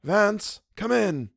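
high, sharp call; crying out. A narrator's reading. Wilde ordering Vance into the study, high cry. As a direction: call him in at once. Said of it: "cried Mister Wilde".

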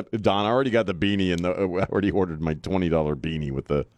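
usual speaking voice

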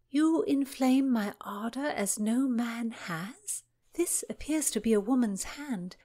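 reading a love letter. Acted. Constance reads love letter aloud.

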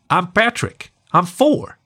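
high, proud little pup voice; little boy pup. A narrator's reading. Small pup introduces himself.

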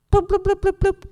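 high, loud bloop bloop like a buzzing phone; chirpy notification bloops; phone alert imitation. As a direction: mimic a phone blowing up with alerts. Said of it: "that's my imitation of a phone blowing up".

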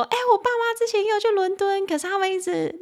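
lightly acted student telling the teacher about his parents; imitating the student. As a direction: play the eager student retelling. Teacher voices her student's story.

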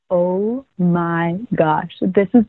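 very slow, low disbelief; stunned dismay. Retold or imitated. Contestant recalling the shock of being on the show, in drawn-out dismay. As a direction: drag out each word in mock dread.

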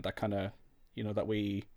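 ordinary talk, nothing marked

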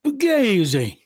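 put-on Italian-accented catchphrase, said with gusto; Waco Italian accent. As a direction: lean into a mock Italian accent on "Fugazi". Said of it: "with that great Waco Italian accent".

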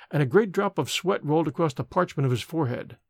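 plain reading voice

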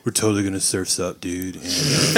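low, slowing surfer-dude voice; Keanu Reeves. Acted. Podcaster parodying the actor in a surf movie, low dude impression. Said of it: "there's no acting chops whatsoever".

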